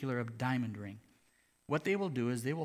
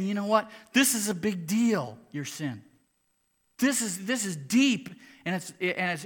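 loud, high, urgent sermon voice; fiery preaching; 6 semitones higher, 9 dB louder than usual. Acted. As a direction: preach it with full force. Preaching at full force.

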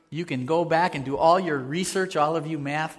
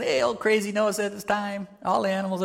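mocking tease; 6 semitones higher than usual. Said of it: "really cranking up the teasing".

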